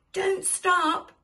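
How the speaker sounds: high, loud shout of encouragement; urgent cry. A narrator's reading. Mister Gumpy to the pushers, crying out. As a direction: cry out to keep them pushing. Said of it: "cried Mister Gumpy".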